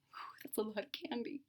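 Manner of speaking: whispered wonder at the pile; awed whisper. Acted. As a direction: hush it, amazed. Maggie marvels at candy haul.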